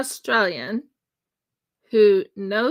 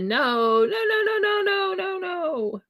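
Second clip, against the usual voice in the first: high-pitched run of mock-frantic no's; retold frantic protest, high and run-on; frantic refusal; 9 semitones higher than usual. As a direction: start normal, then jump high and chain the no's together. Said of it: "frantically shaking my head".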